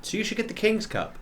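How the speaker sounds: high, soft coaxing voice; suggesting date. Acted. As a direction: pitch it up and softly nudge them. Game master voices the date.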